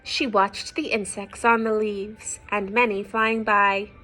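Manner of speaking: lilting storybook read-aloud; reading to children. A narrator's reading. Parent reading a picture book to children, lilting. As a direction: read the rhyme aloud to little ones.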